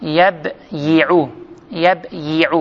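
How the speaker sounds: يبيع very slowly as yab-yi-u, split after yab; underlying form. Shown as a demonstration. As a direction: sound both consonants in يبيع: YAB... YI-u. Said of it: "تۆ بڵێ يجلس".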